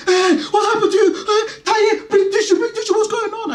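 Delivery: very high, worried mum voice; mum mode. Retold or imitated. Mum fussing over a bloodied boy, high and anxious. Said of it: "jumped into mum mode".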